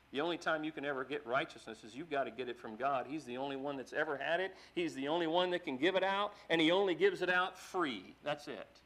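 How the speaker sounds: usual speaking voice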